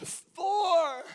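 "for" high, set apart by pauses; stress on for. Shown as a demonstration. Preacher emphasizing each word of the verse, high lone "for". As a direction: lift "for" up high and let it stand alone. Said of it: "you emphasize each word of that".

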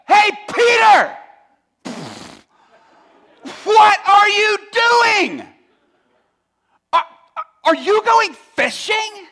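slow, drawn-out jeering yell from shore; mocking shout. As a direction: heckle him across the water. Mocking crews calling from shore.

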